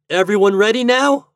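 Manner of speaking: high, loud rallying question; cried out. A narrator's reading. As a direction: call out to the whole group. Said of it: "cried Toad".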